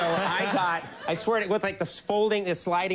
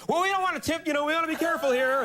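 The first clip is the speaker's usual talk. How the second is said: much higher, quick nervous fussing; mock timid driver; 12 semitones higher, faster than usual. Timid self during driving lesson.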